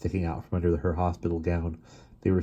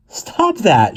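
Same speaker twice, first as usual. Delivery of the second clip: laughing; 13 semitones higher, 9 dB louder than usual. Acted. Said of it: "she laughed".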